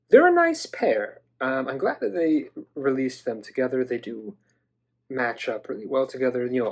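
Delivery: usual speaking voice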